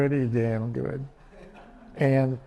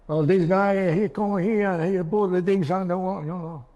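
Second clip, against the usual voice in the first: put-on Cuban accent, louder and higher; broken English, Cuban accent; 5 semitones higher, 4 dB louder than usual. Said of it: "Read it like a Cuban".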